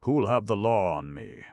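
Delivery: defiant. A narrator's reading. Suren defying the gamekeeper's warning, loud and scornful. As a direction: throw the question back in his face. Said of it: "said Suren defiantly".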